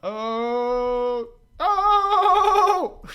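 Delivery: high, loud startled "Oh!"; shocked yelps. Real, not acted. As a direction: yelp in real surprise as it spins away. Model suddenly flies around fast.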